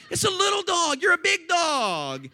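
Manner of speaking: high, exasperated yell at a dog; screaming. Retold or imitated. Pastor retells pulling dog off schnauzer, screaming. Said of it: "I'm screaming at this dog".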